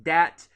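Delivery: "dat" with a loud hard D for TH; Southern D for TH. Shown as a demonstration. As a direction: punch the D at the start of "dat". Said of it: "that's a Southern".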